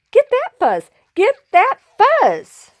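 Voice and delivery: high, urgent grabbing cry; greedy battle cry. Acted. Excited crowd grabs at fuzz.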